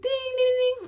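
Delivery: very high sing-song ding; imitated phone ding. Vlogger mimicking her phone call going out, high ding.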